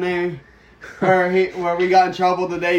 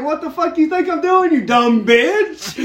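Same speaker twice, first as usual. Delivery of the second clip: mock angry retort; 8 semitones higher, 5 dB louder than usual. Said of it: "he's just like".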